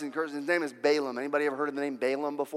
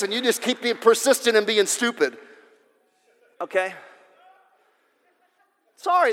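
high, loud sermon drive; fiery preaching; 6 semitones higher, 5 dB louder than usual. Acted. Preacher warning the congregation about disobedience, fiery preaching. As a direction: preach it hard at the crowd.

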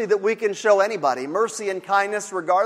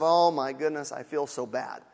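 much softer, lower hollow sigh of pity; mock pity; 8 semitones lower, 8 dB quieter than usual. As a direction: limp sympathy, nearly under the breath. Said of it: "mercy not in just the sense of".

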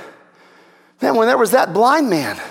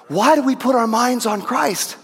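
ordinary talk, nothing marked

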